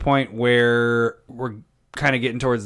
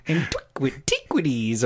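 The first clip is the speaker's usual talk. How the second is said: imitating partner's voice; 5 dB quieter than usual. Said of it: "all of a sudden you just bust out".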